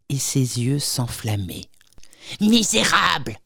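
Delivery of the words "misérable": éclat de colère retenu; s'écrie avec colère. A narrator's reading. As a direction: juste un soupçon de cri furieux. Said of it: "s'écria-t-elle". (la cousine insulte Charles, colère à peine jouée)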